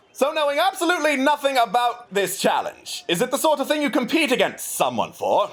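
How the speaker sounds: high, eager royal asking innocently; the naive prince probing the challenge; curious prince. Acted. GM voices Prince Zordan.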